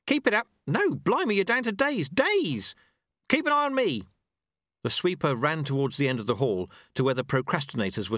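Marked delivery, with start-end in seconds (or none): 0.1-4.1 high, excited shouting. Acted. Sweeper shouting that it's down to days, shouting. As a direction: yell amazed encouragement. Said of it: "he shouted".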